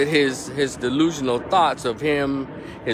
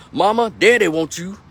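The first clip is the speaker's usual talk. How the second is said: put-on higher and louder, a rough little girl calling; hard-voiced little girl; 8 semitones higher, 7 dB louder than usual. Little girl calling her mother across the street, high and loud. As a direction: yell it across the street like a tough kid. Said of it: "Hard voice ass little girl".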